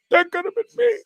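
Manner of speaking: hammed-up regret; mock lament. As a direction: wail it like a jilted loser. Imagined future regret, mock lament.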